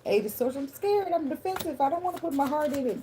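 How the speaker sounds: high comic frightened voice; scared whine. Pretend. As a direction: play a defensive, timid self. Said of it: "You like".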